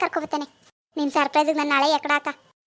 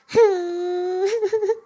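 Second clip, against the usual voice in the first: drawn-out sobbing wail; acted crying. Wife shamed by the shop sign, bawling. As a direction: wail theatrically, then sob. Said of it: "ಅಳ್ಬೇಡ".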